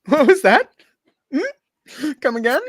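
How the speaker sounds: high, loud, squeaky what was that; mock threat. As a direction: challenge him in a shrill voice.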